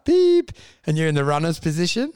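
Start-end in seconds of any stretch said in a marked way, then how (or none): 0.0-0.5 high, loud electronic beeps; sharp mock beeping sounds; beep imitation. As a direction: mimic the beep test tones.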